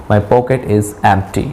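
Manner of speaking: slow and deliberate, every word clear; slow model sentence. Shown as a demonstration. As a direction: spell it out slowly for learners. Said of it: "Once again". (धीरे-धीरे, हर शब्द साफ़)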